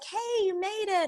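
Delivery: high, drawn-out sing-song hello; imitated gushy welcome; welcoming greeting. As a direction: greet a late arrival with a high, stretched coo. Casting staff greet late actress.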